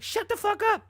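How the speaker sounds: high-pitched screeched outburst; shrieky mock yell. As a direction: imitate her yelling, pitch shooting way up. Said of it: "Blac Chyna was like".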